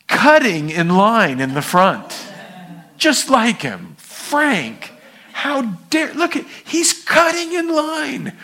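indignant, climbing into a high squeal; mock outrage. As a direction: act scandalized by a queue jumper. Acted outrage at queue jumper.

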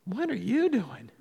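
a hint of a bemused stranger; baffled onlooker. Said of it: "she's looking at me going".

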